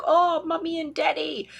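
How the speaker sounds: high, slow adoring puppy voice; doting puppy voice. Retold or imitated. Reader voicing the puppy on the card, high adoring coo. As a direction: coo it like a puppy gazing up at its owners. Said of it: "he's like".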